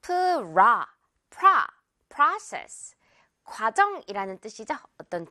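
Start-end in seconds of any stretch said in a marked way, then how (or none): 0.0-3.0 프라 then process with an open ah, rising in pitch. Shown as a demonstration. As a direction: build up to process with a wide ah. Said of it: "프로세스라고 기억하면 안 되겠고요".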